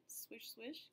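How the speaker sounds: put-on very quiet swishing along with the kids; soft swish echo. Shown as a demonstration. Teacher swishing along with the children, very quiet. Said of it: "Can you do that one?".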